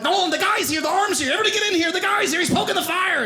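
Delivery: high, urgent shout to the family; excited calling out. Acted. Son calls his family to the fireplace show, excited high shout. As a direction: summon everyone in, bursting with excitement.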